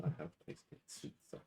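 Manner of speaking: whispered wistful aside. Acted. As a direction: breathe it out wistfully. Character aside, unvoiced.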